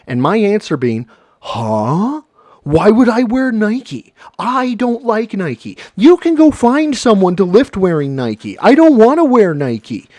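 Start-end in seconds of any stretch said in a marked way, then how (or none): 1.4-10.1 high, loud, drawn-out protest; whiny hammed-up objection; petulant mock refusal. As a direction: play an indignant refusal, pitched way up. Acting out his petulant refusal.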